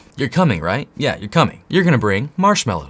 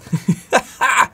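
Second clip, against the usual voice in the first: excited giggle; 9 semitones higher than usual. Real, not acted. Laughing at his own excitement.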